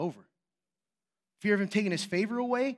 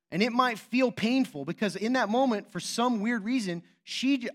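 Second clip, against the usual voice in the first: usual speaking voice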